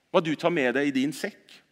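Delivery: ordinary talk, nothing marked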